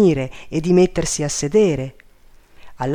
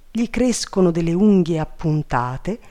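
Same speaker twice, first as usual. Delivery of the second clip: usual speaking voice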